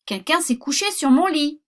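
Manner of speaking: mama bear indignant about her bed; middle bear's voice. Acted. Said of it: "dit l'ours moyen de sa voix moyenne".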